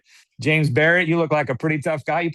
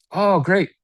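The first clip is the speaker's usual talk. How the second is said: a bright, higher, louder "Oh, great."; pleased relief; 7 semitones higher, 4 dB louder than usual. Pretend. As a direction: sound relieved and delighted. Prospect relieved in role-play.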